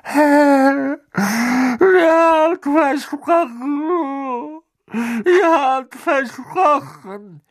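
high, slow, choking plea; whimpering plea. A narrator's reading. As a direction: beg with a broken whine. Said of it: "würgte er hervor".

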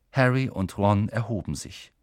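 ordinary delivery, nothing marked

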